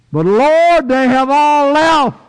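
high, loud anguished cry to the Lord; pleading believer. Acted. Preacher voicing a lonely believer pleading with the Lord, high and loud. Believer's plea voiced in sermon.

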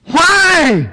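high pleading cry of daddy; a child's plea, pitched high. Retold or imitated. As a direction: call out to the father, pleading. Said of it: "So Jesus cried out".

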